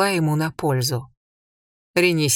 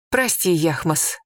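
plain reading voice

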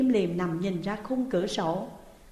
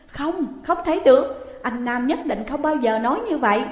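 much higher, louder refusal; desperate denial; 4 semitones higher, 5 dB louder than usual. Acted. Daughter rejecting mother's news, desperate loud denial. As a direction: cry out that it cannot be true.

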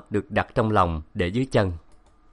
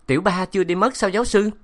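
eager question; 10 semitones higher, faster than usual. A narrator's reading. My asking eagerly, happy.

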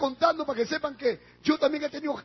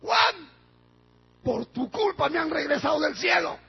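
raised, high accusing cry; imitated shouting; 4 semitones higher, 3 dB louder than usual. Wife back from heaven blaming her husband, shouted. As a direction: call out the blame loudly. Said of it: "gritó".

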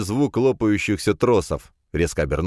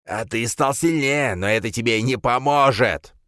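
sneering villain; 6 semitones higher than usual. Acted. Wounded villain threatens.

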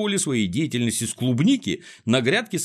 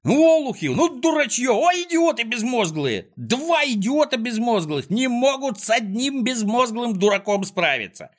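high, loud exasperated insults; shrieking villain tirade; furious ranting; 13 semitones higher, 3 dB louder than usual. Acted. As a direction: rail shrilly at useless helpers. Klops abuses his useless dogs.

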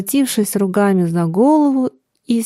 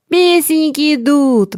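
higher, ringing proclamation; heralding announcement; 6 semitones higher than usual. A narrator's reading. He announces the singers to the company, heralding call. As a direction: announce it with a drawn, raised voice. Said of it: "возвестил он".

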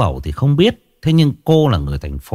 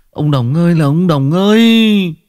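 high, slow, louder wailing complaint; mock lament; 8 semitones higher, 4 dB louder than usual. A narrator's reading. Shaman cursing old Đồng in despair, slow hammed-up lament. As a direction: whine it out in exaggerated despair.

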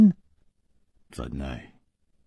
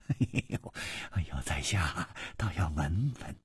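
soft, amused chuckling delivery; hushed, mock-polite amusement; 3 semitones lower, 12 dB quieter than usual. A narrator's reading. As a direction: say it quietly through a chuckle. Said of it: "咯咯的笑着说".

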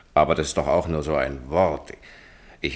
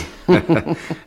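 short laugh; 9 semitones higher, 7 dB louder than usual. Acted. Amused laugh after retort.

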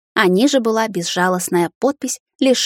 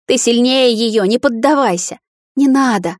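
higher, louder desperate urging; raised, pleading voice; urgent pleading; 4 semitones higher, 4 dB louder than usual. A narrator's reading. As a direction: urge them to resist, crying out. Woman begs Tris to resist.

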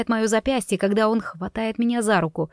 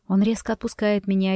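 ordinary delivery

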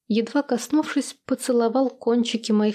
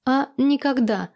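understated snort, pitched higher; snorting retort; 4 semitones higher than usual. A narrator's reading. Heroine to the flattering admirer, lightly snorting retort. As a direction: toss it back with a light scoff. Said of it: "фыркнула я".

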